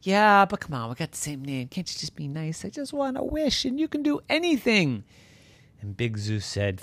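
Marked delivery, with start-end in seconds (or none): 0.0-5.0 high, soft wheedling voice; pleading llama. Acted. Llama begging a god for a wish, pleading.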